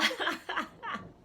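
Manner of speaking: high-pitched burst of laughter; laughing. Real, not acted. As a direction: crack up after your own impersonation. Laughs after mocking the haters.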